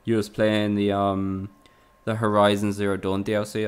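ordinary delivery

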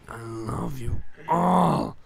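loud, slow, drawn-out declaration. Pretend. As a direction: belt it out, stretching the words. Same words, louder and drawn out.

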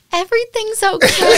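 bright high sing-song "all fine", laughing through it; forced cheerful calm. Retold or imitated. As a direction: pretend all is well to the camera with a nervous laugh. Faking calm to the camera.

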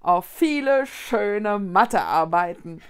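slow, chuckling toast; amused. Acted. Tipsy maths teacher toasting the pupils with a chuckle. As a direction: tease them with a laugh. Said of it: "meinte der Lehrer vergnügt".

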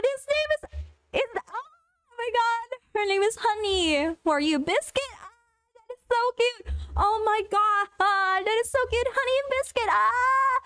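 high, gushing over cute pets; real high-pitched fangirl squeals. Real, not acted. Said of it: "I'm just fangirling".

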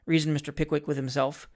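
usual speaking voice

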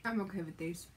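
speaking between bites; chewing while talking. Real, not acted. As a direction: mouth partly full. Seen 0: Left speaker holds pretzel at lips, jaw working between words.

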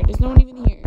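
ordinary talk

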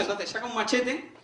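ordinary delivery

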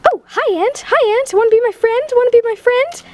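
high, playful little voice; cutesy friend-making voice. Pretend. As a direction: coo it high to a tiny creature. Talks to an ant in cutesy voice.

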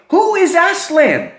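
put-on the children piping up in wonder; children asking. Children ask the beaver about Aslan, high eager voice. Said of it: "the children say".

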